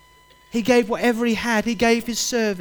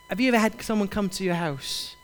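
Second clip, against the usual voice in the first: ordinary talk, nothing marked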